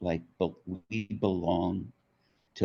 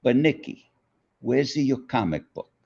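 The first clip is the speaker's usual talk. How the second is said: higher, louder Italian mother asking after the comic book; imitating his mother; 7 semitones higher, 5 dB louder than usual. Son imitates Italian mother quizzing him, higher and louder. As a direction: mimic Mom's sharp, worried question, pitched up. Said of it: "I'll try to say it the way she did".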